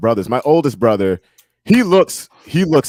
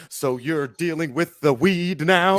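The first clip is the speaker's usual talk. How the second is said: higher, slow mock-maternal question; questioning mother; 5 semitones higher, 3 dB quieter, slower than usual. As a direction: imitate a suspicious mother, drawn out. Imitates his mother questioning him.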